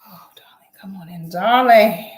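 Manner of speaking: breathy whispered welcome; whispered purr. Acted. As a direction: breathe it like a seductive diva.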